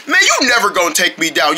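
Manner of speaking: ordinary talk, nothing marked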